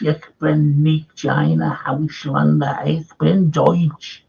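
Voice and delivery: slow, heavy German words, lightly hammed; a lightly hammed German speech for the lecture crowd; mock aggressive German. Shown as a demonstration. Lecturer showing students German, slow mock aggressive German. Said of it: "that sounded very aggressive".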